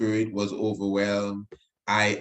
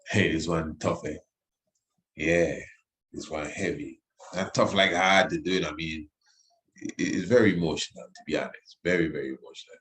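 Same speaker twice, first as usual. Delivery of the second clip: holding back tears; 5 semitones lower than usual. Real, not acted. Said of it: "I'm holding back the tears as I talk".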